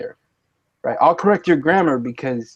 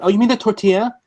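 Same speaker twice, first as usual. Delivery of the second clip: a lightly voiced know-it-all cashier, pitched up; mock correcting cashier; 7 semitones higher, faster than usual. The taco lady correcting his order, mock smug. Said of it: "And she said".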